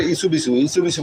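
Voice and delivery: quick ইসু বিসু with w and s swallowed; wrong reading. Shown as a demonstration. Teacher mimics a common mistake, slurring ইসু বিসু. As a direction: run ইসু বিসু together with plain b. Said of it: "এটা পড়া যাবে না".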